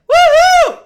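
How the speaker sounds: a very high, loud cheering woohoo. Shown as a demonstration. Teacher showing how screaming teens can cheer safely, whooping. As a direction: whoop it out freely. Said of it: "teach them how to say woohoo".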